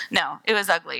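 ordinary talk

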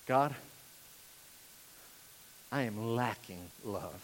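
soft, low, long pauses; humble confession. Acted. Sinner admitting lack of love to God, humbly. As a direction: confess it quietly and haltingly. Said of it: "I'm acknowledging, I'm confessing my lack of love".

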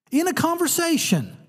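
ordinary talk

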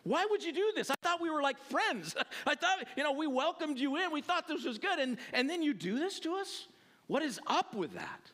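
high-pitched hurt protest; hammed-up, incredulous complaint of a wronged friend; upset Abimelech. Preacher voices the king confronting Isaac, upset. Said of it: "He's upset. He's like".

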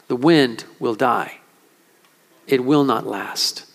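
low, grave pastoral delivery; solemn preaching. Real, not acted. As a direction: speak gravely to the hurting, with long pauses.

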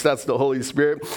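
ordinary talk

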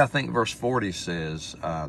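normal talking voice

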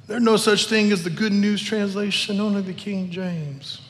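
hint of a put-on critic's voice; mock objector. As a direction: mimic a fussy objection, drawing out the end. Mimics a King James-only objector.

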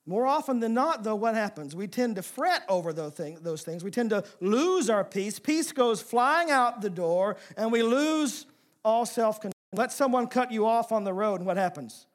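high, loud, driving sermon climb; preaching at full force. Real, not acted. Preacher pressing the congregation on fretting, preaching at full force. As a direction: preach it hard and rising to the crowd. Preacher builds on losing peace.

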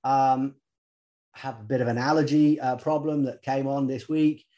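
normal talking voice

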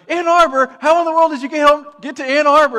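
high, loud disbelieving shout; shocked dad. Retold or imitated. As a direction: blurt it out in total disbelief.

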